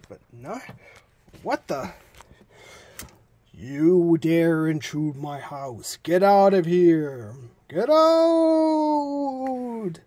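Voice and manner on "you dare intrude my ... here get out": slow ghost voice rising to wail; menacing ghost building to a cry; unearthly voice. Acted. Ghost voice threatens visitors.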